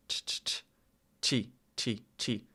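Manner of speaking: ch repeated softly as bare consonant, then chi; isolated ch sound. Shown as a demonstration. Learner testing how the sound goes, soft isolated ch and chi. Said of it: "how does that ch sound?".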